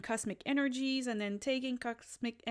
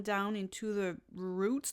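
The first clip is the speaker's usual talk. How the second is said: ordinary talk, nothing marked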